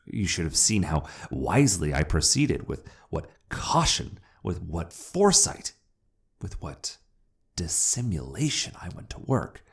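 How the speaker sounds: plain reading voice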